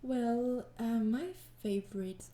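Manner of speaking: ordinary talk, nothing marked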